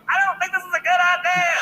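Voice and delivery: high, loud, panicky whine; a grown man's high-pitched fearful protest; scared whine. Retold or imitated. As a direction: whimper it like a frightened grown man. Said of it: "a thirty-year-old man like".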